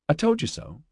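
high, softly sing-song jibe; a light, high-pitched I-told-you-so. A narrator's reading. Liz answers Mark every time he mentions it, told-you-so. Liz's habitual retort, voiced.

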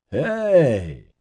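high, drawn-out happy hey; happy exclamation. A narrator's reading. Ozzy spotting Nelson, exclaiming happily. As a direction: greet an old friend gladly. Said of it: "Ozzy exclaimed happily".

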